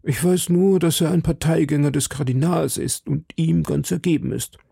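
worried citizen. Acted. Citizen confiding in D'Artagnan about the plot, lightly voiced worried citizen.

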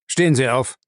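gruffly curt command; short, stern instruction; brusque order. A narrator's reading. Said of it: "sagte Jim barsch".

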